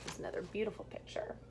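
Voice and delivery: whispered aside. Real, not acted. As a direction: softly whisper about the picture. Aside to listeners about book.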